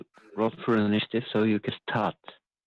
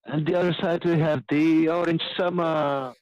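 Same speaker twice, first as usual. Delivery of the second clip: ring announcer; 7 semitones higher than usual. Acted. Dwarf presenting the Orange Summer to the crowd, high announcer call. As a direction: sing out the fighter's name to the crowd.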